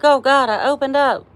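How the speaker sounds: high, loud mock panic; a viewer playfully mocked for oversharing, high panic; mock panicked viewer. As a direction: squeal it like he regrets sharing. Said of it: "He's like".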